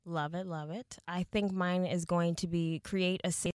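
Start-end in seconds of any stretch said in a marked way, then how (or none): none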